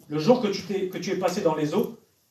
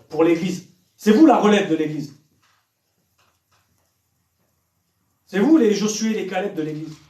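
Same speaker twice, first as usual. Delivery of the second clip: loud, insistent sermon exhortation, driving each phrase; fiery preaching; 2 semitones higher, 6 dB louder than usual. Acted. Preacher urging the young people to pray, loud fiery preaching. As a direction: preach it at full force to the youth.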